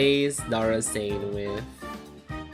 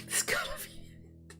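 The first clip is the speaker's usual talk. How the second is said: whisper; 7 dB quieter than usual. Retold or imitated. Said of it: "I'm like".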